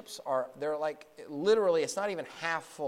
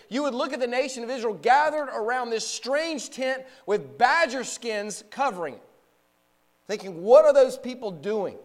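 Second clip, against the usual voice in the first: raised, higher-pitched preacher's voice; forceful preaching; 7 semitones higher, 9 dB louder than usual. Real, not acted. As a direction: preach it out to the whole congregation.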